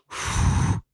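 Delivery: long exhaled breath; heavy sigh. Real, not acted. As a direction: let out a long, steadying breath. Bracing for the next attempt.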